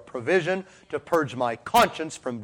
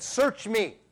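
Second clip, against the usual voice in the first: pleading prayer; 7 semitones higher than usual. Retold or imitated. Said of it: "like David said".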